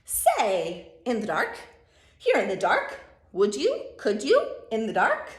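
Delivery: loud, punchy questioning with lifted endings; eager pestering Sam. Acted. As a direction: pester them excitedly, bouncing each phrase. Reads Sam's part of the story.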